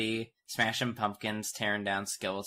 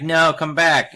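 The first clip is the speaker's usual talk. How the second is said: loud, higher imitated shout; mock calling out; 7 semitones higher, 14 dB louder, slower than usual. Shopkeeper to fleeing girl, mock yelling. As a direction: call after a runaway, playfully.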